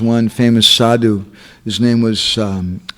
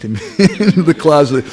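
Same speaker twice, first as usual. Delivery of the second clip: chuckling through the words; amused, laughter breaking into the phrase; laughing; 5 semitones higher, 3 dB louder than usual. Real, not acted. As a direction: laugh while telling it. Amused by his own story.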